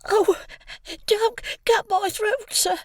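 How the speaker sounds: put-on high, terrified boy's plea; pleading in terror. A narrator's reading. Small boy begging the convict not to kill him, high terrified plea. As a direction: beg for your life like a frightened child. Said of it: "I pleaded in terror".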